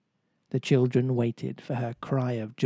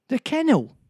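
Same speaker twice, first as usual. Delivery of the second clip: much higher and louder, bursting with discovery; excited cry; 12 semitones higher, 6 dB louder than usual. Acted. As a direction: shout it out as you spot it. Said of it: "cried John".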